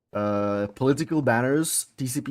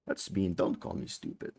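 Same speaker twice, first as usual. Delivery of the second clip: whispered; 8 semitones lower, 11 dB quieter than usual. Real, not acted. Hurt after being called stupid.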